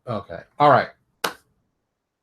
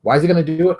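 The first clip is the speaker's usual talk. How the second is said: ordinary delivery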